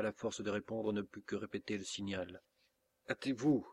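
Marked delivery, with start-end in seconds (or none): 3.1-3.7 raised, louder hurried command; urgent urging. A narrator's reading. Masked man urging Isidora to flee, urgent. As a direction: press them to move at once.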